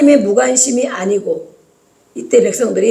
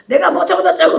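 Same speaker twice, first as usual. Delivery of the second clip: higher-pitched, imitated defiant retort; mock back talk; 8 semitones higher than usual. Children talking back to their parents, mock defiance. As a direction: mimic a child answering back, voice raised in pitch. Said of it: "막, 막 대들잖아요".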